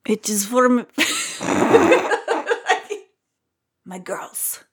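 put-on Russian accent, protective of her girls; Red impression. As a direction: growl it out like a tough Russian prison cook. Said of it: "I can do a good Red impression".

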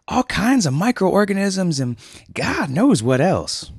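high, loud worried surfer voice; loud high alarmed dude. Acted. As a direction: warn your friend frantically. Julian warns Winston.

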